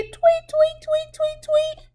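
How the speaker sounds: high chirpy tweeting like a little bird; bird chirp. Acted. Teacher chirping like a bird for the children.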